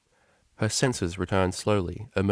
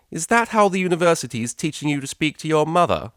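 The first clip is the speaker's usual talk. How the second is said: higher, louder scolding mother voice; a haughty mother dressing down her daughter; mother scolding Octavia over the phone; stern mother; 9 semitones higher, 7 dB louder than usual. Acted.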